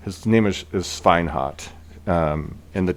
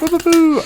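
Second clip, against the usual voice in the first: very high, loud imitated fanfare; playful high "boo, boo, boo"; celebratory hooting; 7 dB louder than usual. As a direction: hoot out a victory noise. Game master celebrates the roll.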